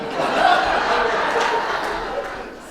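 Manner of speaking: loud breathy laugh. Real, not acted. Announcer cracks up at his own pun, loud and airy.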